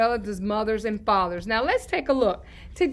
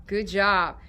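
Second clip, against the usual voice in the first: normal talking voice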